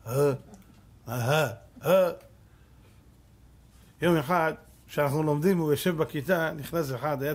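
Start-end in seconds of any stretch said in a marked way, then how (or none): 0.1-2.1 slow, blank grunts of incomprehension; imitating a dull student; dim-witted student. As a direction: play the boy who understands nothing. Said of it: "כמו תפוח אדמה".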